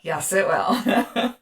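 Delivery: slow, laughing agreement; amused, drawn-out yes. Real, not acted. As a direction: giggle through the words. Still laughing at the pun.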